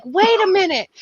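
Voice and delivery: hammed-up Bob voice crying wait; Bob's high protest. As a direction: squeak out a stalling plea like Bob. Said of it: "And Bob's like".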